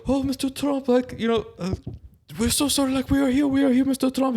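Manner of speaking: very high-pitched pleading underling voice; hammed-up falsetto, fawning and apologetic; grovelling caller. Saudi officials picking up for Mister Trump, grovelling in falsetto.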